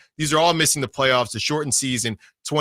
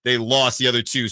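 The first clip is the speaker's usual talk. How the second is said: usual speaking voice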